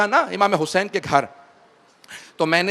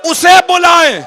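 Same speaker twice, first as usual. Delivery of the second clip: lamenting preacher; 7 semitones higher, 10 dB louder than usual. Acted. Preacher lamenting the mounting of the women to the weeping congregation, loud wailing recitation. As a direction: pour grief over the mourners at full force.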